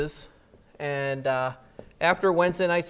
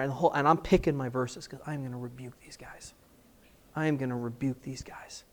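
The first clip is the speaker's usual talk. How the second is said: seething whisper; 4 semitones lower, 7 dB quieter than usual. Retold or imitated. Seething, planning rebuke.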